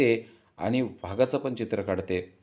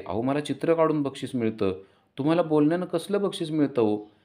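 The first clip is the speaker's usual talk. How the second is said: lightly voiced daughter, pitched up; little daughter; 6 semitones higher than usual. A narrator's reading. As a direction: ask it as the daughter, higher. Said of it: "ती म्हणते".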